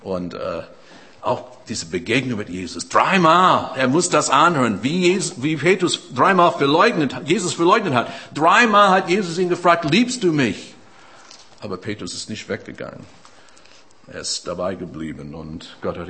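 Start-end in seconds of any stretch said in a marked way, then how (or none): 2.9-10.7 raised, ringing pulpit voice, pressing forward; fervent preaching. Acted. Preacher urging the congregation toward change, in a raised preaching voice. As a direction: preach it out to the whole room.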